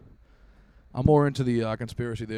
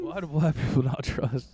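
sketch quote voice; 4 semitones higher than usual. Retold or imitated. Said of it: "Chappelle show".

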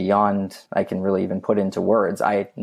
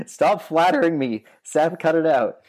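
laughing; 8 semitones higher than usual. Real, not acted. Laughing off the host's praise.

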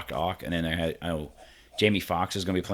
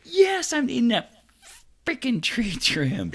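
mock exasperated answer; 14 semitones higher, slower than usual. Mock reply to the caller.

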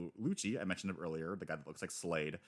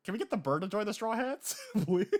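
high, excited begging; high pleading; 13 semitones higher, 4 dB louder than usual. Real, not acted. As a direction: plead for the bird, giddy. Begging to get the bird.

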